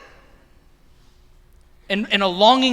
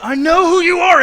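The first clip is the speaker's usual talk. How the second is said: yelled much higher and louder, frantic; retold yell; 12 semitones higher, 10 dB louder than usual. Fighting off friends in ski masks, yelling. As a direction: shout it at the kidnappers like you're fighting them off.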